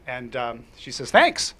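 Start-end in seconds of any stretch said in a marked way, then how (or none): 1.1-1.5 put-on high, bright little-girl thanks; girl's reply. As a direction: voice the kid, up high. Girl voiced in a retold story.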